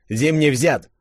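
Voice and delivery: lightly voiced officer's report; Kolchak reporting. A narrator's reading. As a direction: report the victory. (Колчак докладывает о взятии)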